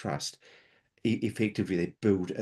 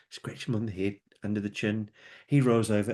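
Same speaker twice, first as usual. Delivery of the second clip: ordinary talk, nothing marked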